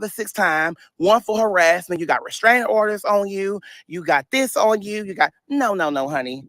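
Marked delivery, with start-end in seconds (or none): none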